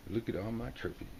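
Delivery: quiet smug murmur of the trophy man; softly pleased with himself; smug boast. Acted. Smug card figure admiring his trophies, murmured softly. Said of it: "This guy looks pretty smug".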